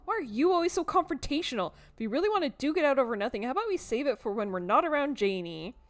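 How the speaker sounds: loud, high, indignant retort; confrontational. Acted. Cousin quarrelling with Tabitha in front of Janie, confrontational.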